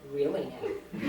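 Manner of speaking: low, quiet disbelief, lightly acted; sceptical psychiatrist. Psychiatrist doubting a zookeeper's story, low and sceptical. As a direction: voice a psychiatrist's calm doubt, mildly.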